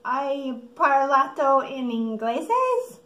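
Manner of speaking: slow, loud Italian in a mock American accent; stupid American accent. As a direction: play the clueless American mangling Italian. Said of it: "in my stupid American way".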